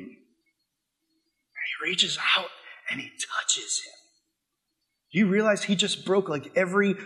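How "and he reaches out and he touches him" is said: slow, dropped to a whisper; hushed. A narrator's reading. Telling the church of Jesus touching the leper, hushed.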